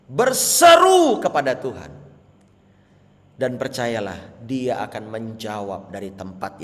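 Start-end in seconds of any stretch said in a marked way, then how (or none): 0.1-1.9 loud, high cry to God. Real, not acted. Preacher to congregation, calling out loudly. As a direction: call it out with full force.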